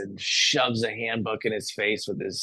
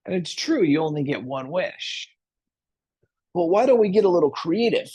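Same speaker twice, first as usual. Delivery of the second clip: a hint of a scheming genie, pitched higher; higher, lilting pitch for a persuasive genie; lightly voiced genie; 6 semitones higher than usual. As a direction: coax with a lightly acted, higher voice. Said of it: "And the genie says".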